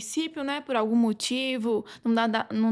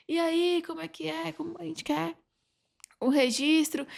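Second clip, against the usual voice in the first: mock townspeople demanding; 5 semitones higher than usual. Residents pressing councillors for land titles, lightly voiced and higher. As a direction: play the crowd asking, pitched up and hushed.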